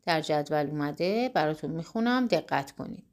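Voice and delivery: usual speaking voice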